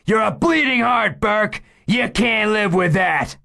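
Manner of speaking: high, loud, shouted taunt; furious shout. Acted. Gunman shouting at the officer, wild furious yell. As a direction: spit it at him, wild and loud.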